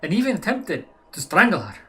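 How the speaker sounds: hammed-up German accent, drifting toward French. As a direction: push through the German accent as it slips. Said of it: "pulling into a French accent".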